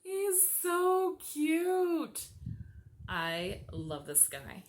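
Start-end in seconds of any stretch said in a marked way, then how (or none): 0.0-2.3 cooing. Real, not acted. Coos over Funko.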